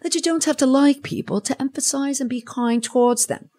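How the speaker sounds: exaggerated amateur British accent. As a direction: read it out in a mock British voice. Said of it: "I should have done this in a British accent".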